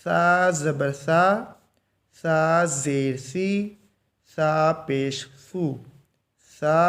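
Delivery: calm drawn-out syllable drill; slow drill recitation. Shown as a demonstration. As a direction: chant each syllable slowly, no jerk. Drills letters with vowels. (आराम से धीमा सबक़)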